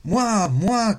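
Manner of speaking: put-on high, loud childish shout; the boy cries out eagerly to the sphinx; eager cry. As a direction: shout it like a kid who knows the answer. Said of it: "cria alors Aignan".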